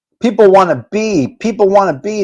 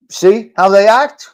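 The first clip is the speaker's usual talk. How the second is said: ordinary talk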